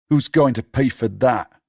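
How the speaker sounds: bitter complaint; angry. Acted. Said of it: "He sounds angry".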